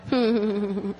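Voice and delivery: short rapid chuckle; real, easy laugh. Real, not acted. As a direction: chuckle briefly to yourself. Laughs after congregation responds.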